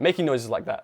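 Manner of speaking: ordinary talk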